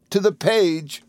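high, tearful wife voice; sobbed. A narrator's reading. Wife answering husband, high acted sob. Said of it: "sobbed Dora".

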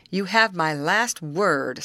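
slow, weighty final warning; grim. A narrator's reading. Rescuer giving the camp boss an ultimatum, grim. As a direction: spell it out firmly, understated grimness. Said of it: "said Mister Hammond grimly".

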